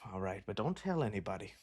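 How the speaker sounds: low, soft, playfully secretive; coy hush. Acted. Playful aside after asking for kiss.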